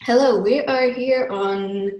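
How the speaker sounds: ordinary talk, nothing marked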